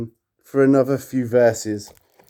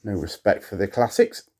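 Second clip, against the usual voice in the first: normal talking voice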